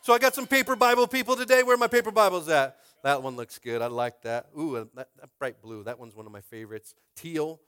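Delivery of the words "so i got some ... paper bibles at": excited. Real, not acted. Hyping up the congregation.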